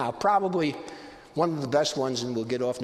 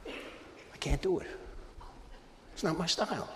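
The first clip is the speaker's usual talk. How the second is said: quiet refusal; 2 semitones higher, 9 dB quieter than usual. Retold or imitated. Inner refusal to pay full price.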